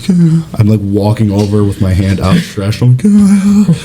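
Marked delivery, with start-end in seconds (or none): 0.0-0.5 high, halting plea; retold hungry pleading, pitch raised; eager begging. Begging mom for food. 3.0-3.8 very high, put-on pleading voice; eager begging. Begging mom for food.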